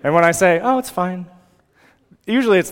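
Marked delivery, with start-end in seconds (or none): 0.6-1.3 higher-pitched, dismissive brush-off; unconvincing reassurance. Retold or imitated. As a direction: insist all is fine without meaning it. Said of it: "not really believe me when I say".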